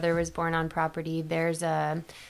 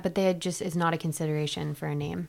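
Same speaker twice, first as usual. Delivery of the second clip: ordinary talk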